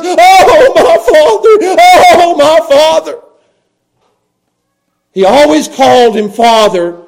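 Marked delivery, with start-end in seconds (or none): 0.0-3.2 loud, high wailed plea; anguished crying out. Acted. Jesus repeats his prayer to the Father, wailing. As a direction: cry it out in agony, stretched and tearful. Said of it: "he says it all three times, same words".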